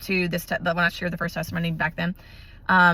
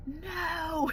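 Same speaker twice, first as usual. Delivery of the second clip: soft, high "No." said under the breath, barely voiced; hushed refusal; 9 semitones higher, 10 dB quieter than usual. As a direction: breathe out a small, high "No." in disbelief. Told more cavities, frustrated.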